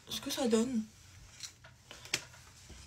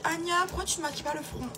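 higher-pitched mock questioner; imitating questioners; 6 semitones higher than usual. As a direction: quote the nagging question in a raised voice. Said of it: "À chaque fois, on me dit".

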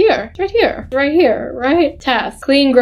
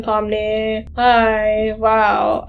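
ordinary talk, nothing marked

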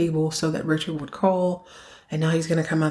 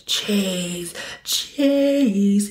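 creepy calling voice; 2 semitones higher than usual. Retold or imitated. A hidden stalker calling Chase in the wine house, eerie. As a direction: lure him out with a drawn-out call.

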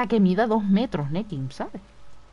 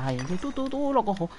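ordinary delivery

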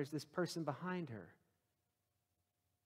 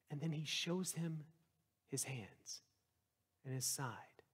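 whisper; 8 dB quieter, slower than usual. Real, not acted. Preacher drops to hushed revelation.

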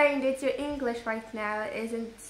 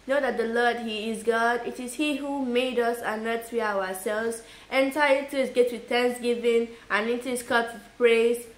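Bible recitation; 5 dB louder than usual. Pretend. Child reciting a psalm for the family, declaimed recitation. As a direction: recite scripture on one steady note, projecting.

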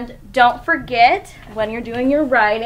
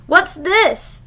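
higher, mild storybook scream; a touch of a scream, higher; mock scream; 6 semitones higher, 4 dB louder than usual. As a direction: shriek it out in a cross dinosaur voice. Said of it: "screamed Bella".

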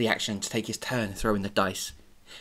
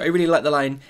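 plain reading voice

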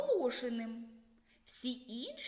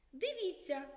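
put-on girl's call; 7 semitones higher than usual. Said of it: "зауважила Оленка".

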